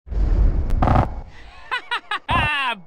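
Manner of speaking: loud victorious laugh; triumphant laugh. Real, not acted. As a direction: burst out laughing at the win. Laughs after winning the battle.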